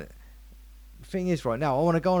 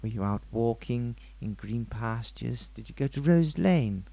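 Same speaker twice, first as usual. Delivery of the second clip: put-on psychiatrist; 7 semitones lower than usual. Psychiatrist prompts his patient's memory, low psychiatrist voice. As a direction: lead the patient on in a mock therapist voice. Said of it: "I'm a psychiatrist".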